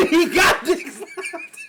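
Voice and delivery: high, slow, squeezed out through laughter; helpless laughing. Real, not acted. Podcaster losing it over a crude joke, laughing hard. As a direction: crack up while saying it.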